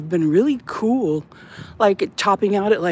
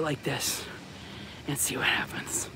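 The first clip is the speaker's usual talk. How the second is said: fading to a whisper; 6 semitones lower, 14 dB quieter than usual. Real, not acted. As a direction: drop to a hushed whisper. Fades to whisper.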